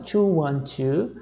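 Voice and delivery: slow digit-by-digit reading; phone number spelled out slowly, digit by digit; one by one. Shown as a demonstration. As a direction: read each number separately, slowly. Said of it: "eu posso ler um de cada vez".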